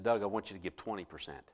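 hushed and low, barely above a murmur; soft, low inner voice speaking his name; quiet voice of God. Retold or imitated. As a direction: let it come softly, like an inner voice.

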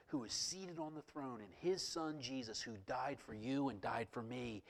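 ordinary talk, nothing marked

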